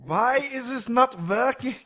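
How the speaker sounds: high, German-sounding mock Russian accent; attempted Russian, sounds German. Russian geneticist's frustration at failing science, botched German-sounding accent. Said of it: "Also, that was German".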